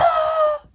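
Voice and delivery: high wailing cry, acted out; imitated sob wail. Nurse breaking down in the office with her charge nurse, high wail. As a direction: let out a sudden high sob. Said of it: "and I was like".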